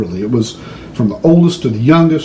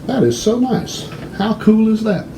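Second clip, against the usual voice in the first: lightly hammed-up posh purchase, pitched high; a hint of stiff formality, then delight; mock formal; 7 semitones higher, 4 dB quieter than usual. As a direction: play the overly polite tourist. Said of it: "And I said".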